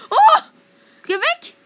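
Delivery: very loud, very high alarmed yell; panicked shriek. Real, not acted. Gamer fending off an attacker, panicked shriek. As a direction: shriek to drive them off. Fends off enemy in game.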